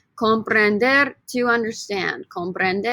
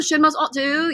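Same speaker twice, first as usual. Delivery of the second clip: higher put-on British accent; 5 semitones higher than usual. Shown as a demonstration. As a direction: mimic the Brits with a British accent. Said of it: "say it with a British accent".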